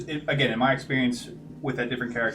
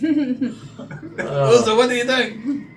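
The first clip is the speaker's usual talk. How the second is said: character voice; 10 semitones higher, 6 dB louder, much slower than usual. Acted. DM voicing Usu, high.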